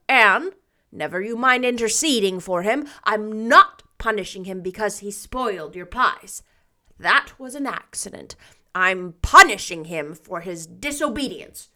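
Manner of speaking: loud, firm, even voice; firm old woman. A narrator's reading. Marilla explains Davy's punishment to Anne, loud and firm. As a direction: lay down the rule.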